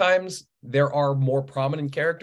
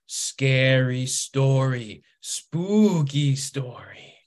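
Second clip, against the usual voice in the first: put-on slow, sing-song little-kid voice; imitating toddler; much slower than usual. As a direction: stretch each word like a two-year-old storyteller. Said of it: "she would just look at us and go".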